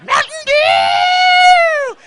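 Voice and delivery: high, long, hammed-up roar; sugar-crazed scream. Brother hopped up on caffeine, hammed-up ecstatic scream.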